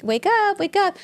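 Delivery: high-pitched retold call to wake up; nurses waking her. Nurse rousing a groggy patient, high mock call. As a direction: mimic the nurse calling her awake, voice up high.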